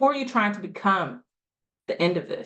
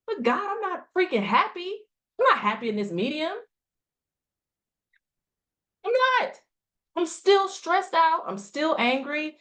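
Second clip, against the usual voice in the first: frustrated venting; 6 semitones higher than usual. Acted. Coach acting out a client's inner frustration, high and exasperated. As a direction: vent like someone fed up and stuck.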